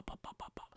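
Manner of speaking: soft breathy pa pa pa; tripping sound effect. Pretend. Picturing runners tripped over a stick, soft popping noise. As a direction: tap out each trip with quick puffs.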